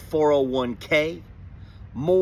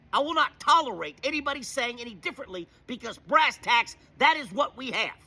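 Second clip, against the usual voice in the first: pitched up, sneering Trump-voter parody; mocking Trumper voice; 7 semitones higher than usual. Ranting to viewers, mocking Trump voters in a pitched-up sneering voice. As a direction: lampoon a smug Trump supporter, higher.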